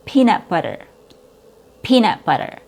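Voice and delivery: peanut with a long ee; long E sound. Shown as a demonstration. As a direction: peanut butter, held ee, flapped t in butter. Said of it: "It has a long E sound".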